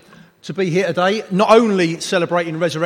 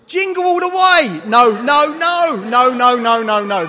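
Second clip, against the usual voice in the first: loud, high, appalled rejection; mock outrage; 4 semitones higher, 7 dB louder than usual. Preacher hearing a wrong Arnie film suggestion, mock outrage. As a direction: reject it loudly in playful horror.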